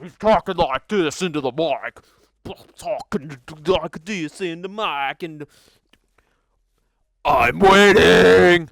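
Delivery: imitating dialogue recorded too far from the mic; slow, hollow mock of bad audio, last line loud; muffled mic imitation. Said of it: "because it sounded like".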